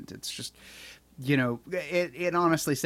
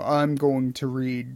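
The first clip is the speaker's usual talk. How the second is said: Stefan Rudnicki; 4 dB louder than usual. Retold or imitated. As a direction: draw each word out like an audiobook reader. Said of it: "someone like Stefan Rudnicki, who's like".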